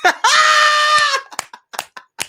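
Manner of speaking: high screaming laugh burst; loud high squealing laugh; shrieking laugh. Real, not acted. Startled by cricket comment.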